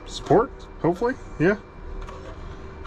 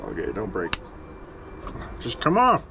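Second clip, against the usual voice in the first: normal talking voice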